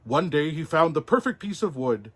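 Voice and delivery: plain reading voice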